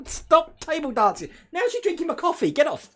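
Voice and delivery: high, loud amused scolding; exasperated shouting. Real, not acted. Owner to dog drinking his coffee, loud amused exasperation. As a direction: call the dog off, half laughing.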